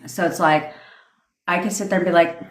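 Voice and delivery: usual speaking voice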